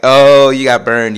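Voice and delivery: loud, drawn-out mocking jeer; gleeful taunt. Son gloats as mom gets burned, teasing. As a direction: stretch the "oh" and crow. Laughing at mom being caught out.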